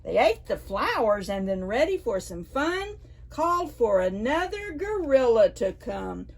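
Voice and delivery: usual speaking voice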